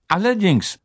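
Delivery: high, emphatic reply while munching; chewing as she speaks; mouth full. A narrator's reading. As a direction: answer through a mouthful. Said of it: "mampfte Hermine".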